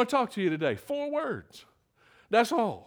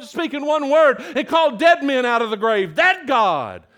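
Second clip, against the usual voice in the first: high, driving sermon build; impassioned pulpit delivery; full-force preaching; 4 semitones higher, 3 dB louder than usual. Real, not acted. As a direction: hammer each "that God" home.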